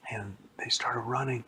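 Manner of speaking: ordinary delivery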